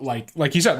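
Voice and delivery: ordinary delivery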